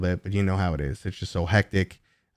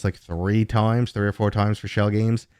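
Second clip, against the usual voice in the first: ordinary delivery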